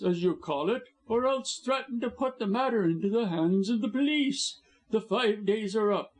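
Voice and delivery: gloating high villain voice; scheming blackmailer. A narrator's reading. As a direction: lay out the terms smugly.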